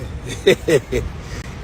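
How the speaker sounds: a quick airy laugh; short breathy chuckle. Real, not acted. Cohost laughing at advice.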